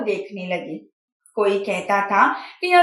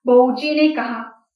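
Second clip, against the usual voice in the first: ordinary delivery, nothing marked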